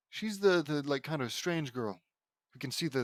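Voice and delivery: usual speaking voice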